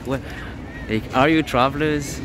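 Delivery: usual speaking voice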